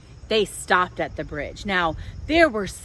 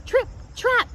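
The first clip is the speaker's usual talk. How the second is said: "Trip, trap" piped much higher, light and quick. A narrator's reading. Little goat hooves on the bridge, high piping trip trap.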